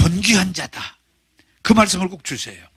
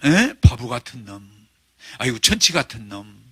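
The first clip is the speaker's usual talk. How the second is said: put-on old father scolding; 9 semitones lower than usual. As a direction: scoff at him like a fed-up old dad. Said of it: "우리 옛날 어른들은 거의 그랬어요".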